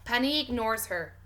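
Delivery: ordinary delivery, nothing marked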